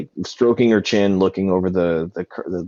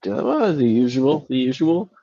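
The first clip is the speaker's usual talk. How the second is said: lightly voiced gnome woman, higher pitched; higher, drawn-out questioning at the end; Girthwood; 6 semitones higher, slower than usual. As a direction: play the gnome bartender, pitched up a little. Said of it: "And Girthwood's like".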